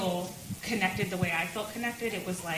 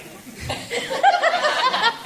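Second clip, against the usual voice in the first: high laughter; 9 dB louder than usual. Real, not acted. Laughing about pastor crying.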